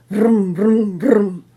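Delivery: put-on playful vroom like a kid on a toy bike; motorcycle noises. A narrator's reading. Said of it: "he yells".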